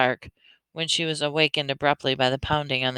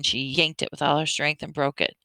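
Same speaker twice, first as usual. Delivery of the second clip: ordinary delivery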